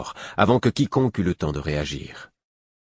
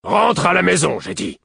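much louder, high-pitched furious command; angry roar; 13 semitones higher, 6 dB louder than usual. A narrator's reading. Father repeats the order to his defiant son, roaring. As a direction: roar the order at a disobedient child. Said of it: "et Buric gronda".

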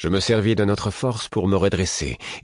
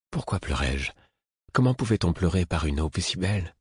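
ordinary delivery

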